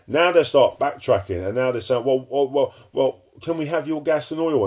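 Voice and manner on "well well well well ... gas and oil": higher, wheedling beggar's voice; mock pleading. Preacher mocking European leaders begging Putin, pleading. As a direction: beg sheepishly, mocking them.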